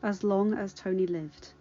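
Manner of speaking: ordinary delivery, nothing marked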